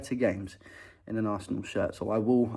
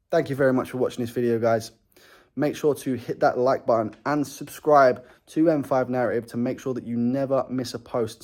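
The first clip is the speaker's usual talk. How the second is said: ordinary talk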